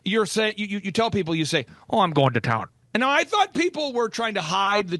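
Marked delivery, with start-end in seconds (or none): 1.9-2.7 low, easy-going Minnesotan oh; lightly voiced Minnesotan local; Minnesotan accent. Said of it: "speak Minnesotan".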